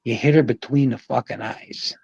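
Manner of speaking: ordinary delivery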